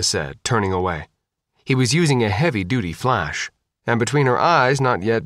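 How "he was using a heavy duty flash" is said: usual speaking voice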